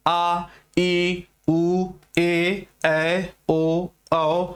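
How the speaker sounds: each vowel आ, ई, उ, ए, ऐ, ओ, औ sounded alone and clearly; vowel demo. Shown as a demonstration. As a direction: pronounce the vowels one by one, cleanly separated. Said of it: "किसी भी वर्ण की सहायता नहीं लेनी पड़ रही है".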